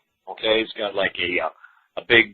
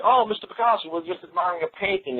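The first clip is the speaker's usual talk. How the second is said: fawning art critic; 7 semitones higher than usual. Pretend. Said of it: "And it's like".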